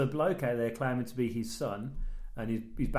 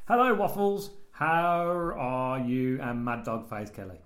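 slow, laboured reading in broken English; Rob K; slower than usual. Acted. Host reads listener's letter to co-host, slow character voice. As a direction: read it as a halting, earnest simpleton.

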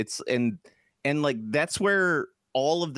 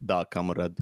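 low mock Russian accent; lightly put-on Russian comrade; 6 semitones lower than usual. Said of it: "how you start off with Russian accent".

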